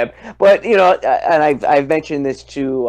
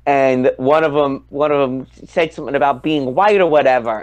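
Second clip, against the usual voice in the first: ordinary talk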